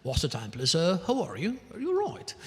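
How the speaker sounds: put-on British English accent. Shown as a demonstration. Showing off English learned to speak like the locals, British accent. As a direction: imitate the British accent, pitch swinging widely. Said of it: "falar inglês com sotaque britânico".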